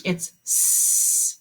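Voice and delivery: s said as a long hiss, no vowel; held hiss. Shown as a demonstration. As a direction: make the snake sound s. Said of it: "Can you make that sound for me".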